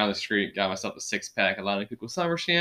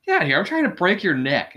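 raised, louder playful disbelief; hammed-up indignant brush-off, pitched up; mock indignation; 9 semitones higher, 5 dB louder than usual. Fan imagining himself as the complimented defender, high mock indignation. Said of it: "I'd be like".

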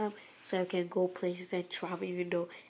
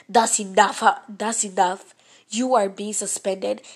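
loud angry scolding; stern shout; 10 dB louder than usual. Retold or imitated. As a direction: shout the punishment down loudly. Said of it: "the prince was like".

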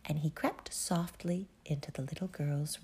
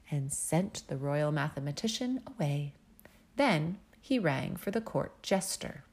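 ordinary delivery, nothing marked

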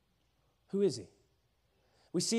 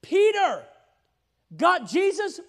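usual speaking voice

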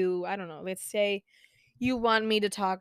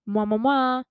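string of whispered air kisses; big wet smooch; 4 semitones higher, 5 dB louder than usual. Real, not acted. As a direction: blow three quick kisses. Blowing kisses goodbye.

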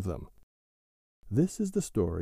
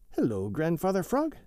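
put-on lightly raised, perky young mink; a cheerful little mink calling hello; Billy Mink voice; 7 semitones higher than usual. A narrator's reading. Billy Mink greets the frog.

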